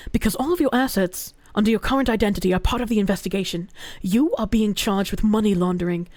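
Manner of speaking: low, even reading voice; article reading. A narrator's reading. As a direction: read the story out steadily. Reads article aloud.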